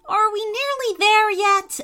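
put-on high, loud impatient child voice; eager kids on a trip. A narrator's reading. Two brothers on the drive to Disney World, eager and high. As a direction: pipe up high like an excited kid. Excited boys whining on car trip.

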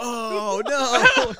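high, loud, horrified oh no; hammed-up dismay; mock shocked gasp. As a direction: cry out as if your heart breaks. Shocked reaction to niece.

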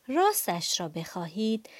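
plain reading voice

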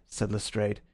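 ordinary delivery, nothing marked

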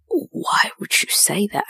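breathy whispered disbelief; disgusted whisper. Retold or imitated. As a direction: whisper it, appalled. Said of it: "I'm like".

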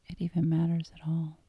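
usual speaking voice

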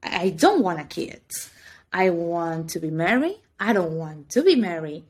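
usual speaking voice

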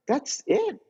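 high, disbelieving "that's it?"; incredulous. Retold or imitated. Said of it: "And I was like".

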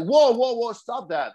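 high, urgent call to stop, retold; jumping in to stop the choking, high and urgent; alarmed whoa. Retells stopping the guy again.

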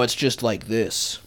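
slow, firm voice from the diaphragm; diaphragm-heavy voice. Shown as a demonstration. Showing how Vegeta sounds now, diaphragm-heavy. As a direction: push it slowly from the diaphragm. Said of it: "It's, like, more diaphragm-y".

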